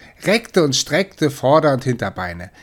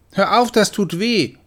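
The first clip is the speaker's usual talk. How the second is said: pained protest; 8 semitones higher, 3 dB louder than usual. Acted. Bitten in tail.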